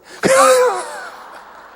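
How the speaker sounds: a high, loud laugh at himself; burst of laughter. Real, not acted. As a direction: laugh out at the absurdity. Laughing at own story.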